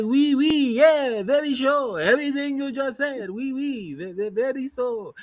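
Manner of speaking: cartoon French accent, stretched out; mock French accent like a cartoon skunk; Pepe Le Pew French accent. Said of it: "I'm trying to sound like Pepe Le Pew".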